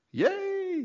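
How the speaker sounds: high, gleeful yay; mock cheer. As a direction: cheer like the reapers seeing the ark. Townspeople rejoice seeing the ark.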